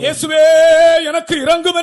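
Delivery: high, loud plea to Jesus; crying out for mercy. Acted. Preacher models the congregation's cry, loud and pleading. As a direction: call out to Jesus with all your heart.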